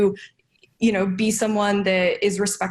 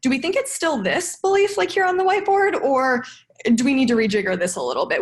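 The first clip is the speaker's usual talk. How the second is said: questioning the rep; 5 semitones higher than usual. Retold or imitated. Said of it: "be like".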